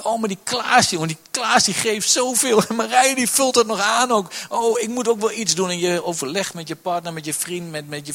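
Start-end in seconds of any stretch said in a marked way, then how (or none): 0.0-5.7 higher, louder envious gossip about Klaas and Marije; envious churchgoer. Retold or imitated. Preacher mocks a churchgoer comparing gifts, in a higher envious voice. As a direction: mimic a jealous churchgoer, raised and eager. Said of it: "als je denkt".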